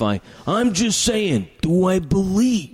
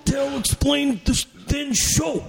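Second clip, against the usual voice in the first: Macho Man voice; 6 semitones higher than usual. Retold or imitated. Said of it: "can you do your voice like Macho Man?".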